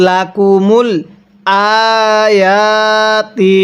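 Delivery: "আয়াতি" very slow, with both the hamza and the ya drawn out; double stretch. Shown as a demonstration. Teacher shows two stretches in one word. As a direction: hold both vowels in "আয়াতি". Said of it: "তাহলে".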